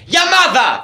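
high exclamation as a psychic; mock medium. Medium mimicked.

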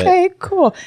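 high, sing-song okay cool; high mock okay. Brushing off friend's fiancé in high sing-song. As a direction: brightly fake acceptance. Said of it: "And I was like".

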